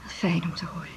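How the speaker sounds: soft, breathy relief; relieved whisper. Acted. Relieved at hopeful news.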